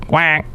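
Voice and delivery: a light quacking noise; duck quack. Acted. Host imitates a duck, a light quack. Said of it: "What's this bird over here?".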